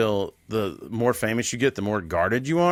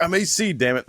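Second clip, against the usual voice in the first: a hint of A C catchphrase; 7 semitones higher than usual. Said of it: "And I'm like".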